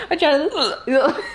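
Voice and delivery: laughing through hiccups, slow; hiccup giggles. Real, not acted. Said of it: "Every time I laugh, I hiccup more".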